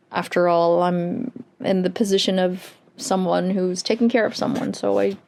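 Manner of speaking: usual speaking voice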